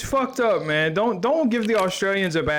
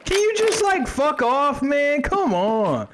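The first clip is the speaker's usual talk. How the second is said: high-pitched, pestered complaint; exasperated plea; 8 semitones higher, slower than usual. Real, not acted. Player tells a pestering enemy to leave, high exasperated plea. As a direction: whine it high, fed up.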